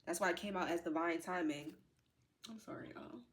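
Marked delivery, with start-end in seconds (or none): 2.5-3.2 very soft, trying to hold it together; overcome, voice dropped right down; emotional apology. Real, not acted. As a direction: apologise quietly through rising tears. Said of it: "let me get myself together".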